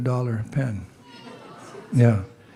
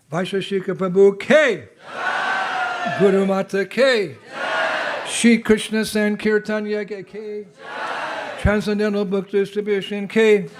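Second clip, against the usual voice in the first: raised, ringing calls with a shouted Jai; rally the crowd with each name; call-and-response cheers; 6 semitones higher than usual. Real, not acted. Monk leading the crowd in jai calls, shouting.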